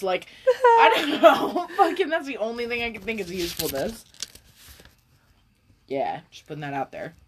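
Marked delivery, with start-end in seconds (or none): 0.5-1.7 high, slow laughing giggle. Real, not acted. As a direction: laugh the words out. Laughing trail-off.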